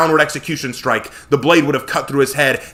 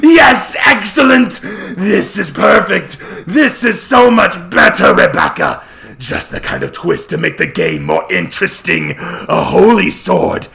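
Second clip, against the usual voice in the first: high, loud, gleeful cackling praise; a delighted god laughing over the holy sword; excited laughing villain; 7 semitones higher, 6 dB louder, slower than usual. Acted. Set delighting in the consecrated blade, laughing and shouting. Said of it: "He was excited".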